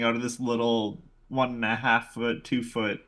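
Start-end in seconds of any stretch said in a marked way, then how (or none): none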